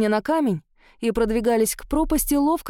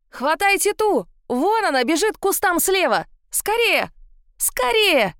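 high, urgent yelling; shouted orders; 9 semitones higher, 3 dB louder than usual. A narrator's reading. Guard sends men after the runaway, shouting. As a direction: shout to the men to give chase.

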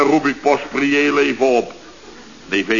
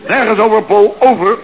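luid en geïrriteerd; 8 semitones higher, 8 dB louder than usual. Acted. Geïrriteerde chef via zender.

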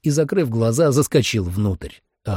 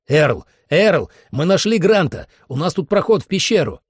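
high, excited, raised voice; urgent call; 7 semitones higher, 3 dB louder than usual. Acted. Narrator summons Earl with news of Grant, urgent call.

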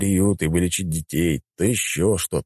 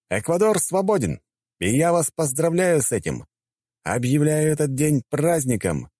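amplified voice; 10 semitones higher than usual. A narrator's reading. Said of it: "усилил свой голос и заговорил".

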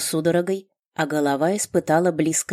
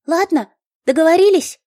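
high, eager gnome voice; chirpy gnome explaining; 14 semitones higher, 9 dB louder than usual. A narrator's reading. Gnome insists.

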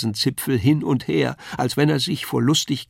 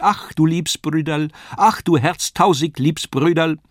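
higher, louder fond clown voice, grinning; grinning puppet call; 5 semitones higher, 4 dB louder than usual. A narrator's reading. Kasperl grins down at the dreaming boy, calling fondly. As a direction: grin and nod while crying it out. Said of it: "rief, grinsend auf mich herabnickend".

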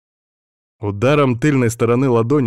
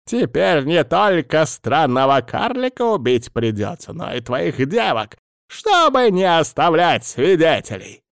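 a hint of a high, sneering villain; menacing villain; 6 semitones higher than usual. A narrator's reading. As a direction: gloat over killing the witnesses. Villain threatens hero.

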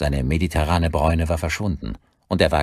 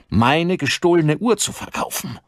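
raised, high indignant burst; angry accusation; 12 semitones higher, 4 dB louder than usual. Acted. Father confronts son over stolen watch, angry raised voice. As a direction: let the outrage flare up.

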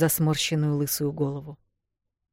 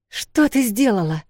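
higher, louder shocked cry; stunned cry; 6 semitones higher, 4 dB louder than usual. Acted. Эл crying out to her sister in shock. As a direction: shout it out in disbelief. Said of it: "ошарашенно прокричала Эл".

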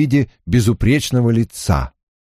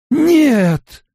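high desperate cry of no; scream. A narrator's reading. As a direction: shriek it in horror. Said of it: "закричала Найнев".

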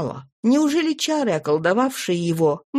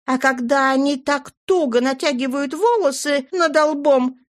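high, fluttery chatter; gushing lady; 8 semitones higher than usual. Acted. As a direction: gush like a flustered old flame. Flora's gushing speech.

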